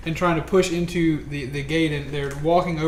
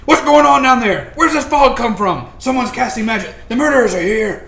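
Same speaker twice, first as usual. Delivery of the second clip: guards shouting; 7 semitones higher, 9 dB louder than usual. Acted. GM voices tower guards calling down.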